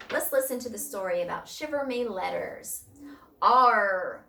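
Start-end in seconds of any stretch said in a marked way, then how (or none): 3.4-4.3 loud, long pirate "Arr"; storybook captain bellowing; pirate captain's roar. A narrator's reading. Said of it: "roared the captain".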